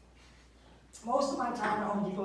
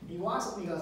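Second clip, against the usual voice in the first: normal talking voice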